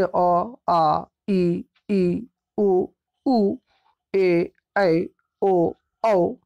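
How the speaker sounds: each vowel said out clearly, one by one, like a teacher's drill; reciting Hindi vowels. Shown as a demonstration. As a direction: sound out अ, आ, ई, उ, ऊ, ए, ऐ, ओ, औ for the class. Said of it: "first sound Hindi के स्वर्ण वर्ण यानी vowel".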